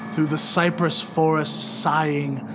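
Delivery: usual speaking voice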